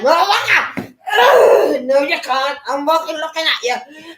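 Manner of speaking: high, loud no, no, no; defiant shout. Retold or imitated. Retelling fighting the watchers off, loud defiant shouting. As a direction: shout them down, refusing to give in. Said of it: "I was like".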